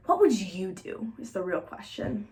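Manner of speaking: ordinary delivery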